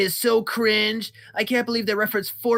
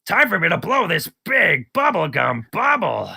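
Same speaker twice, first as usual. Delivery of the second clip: cartoon character voice. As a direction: play it like a silly cartoon.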